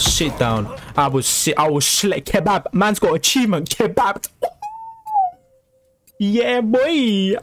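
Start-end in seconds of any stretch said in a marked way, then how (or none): 2.3-7.4 high-pitched victory crowing; triumphant celebration. Real, not acted. Gamer crowing over a kill and achievement, triumphant celebration.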